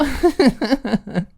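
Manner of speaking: high, loud tipsy cackle; giddy drunk laugh; drunken laugh. A narrator's reading. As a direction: laugh loudly at your own joke. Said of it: "She laughed at her own joke".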